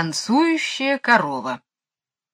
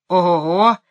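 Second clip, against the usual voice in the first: sudden loud whoop; excited yell; 6 dB louder than usual. A narrator's reading. Michael spots something in the lane, yelling excitedly. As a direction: burst out loudly in great excitement. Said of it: "завопил вдруг Майкл в величайшем возбуждении".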